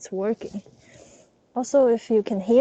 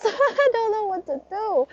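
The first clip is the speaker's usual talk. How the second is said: higher, laughing through the nerves; nervous laugh; 10 semitones higher than usual. Real, not acted. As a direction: giggle it out anxiously. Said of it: "I am so freaking nervous".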